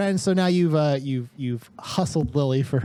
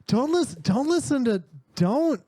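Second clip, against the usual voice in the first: pitched up, pleading; higher, nervous teen begging; high panicky voice; 6 semitones higher than usual. Acted. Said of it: "Rex is like".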